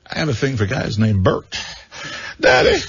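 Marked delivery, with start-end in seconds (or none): 2.4-2.8 squealed high Daddy. Acted. Son squeals to his father, high and rising.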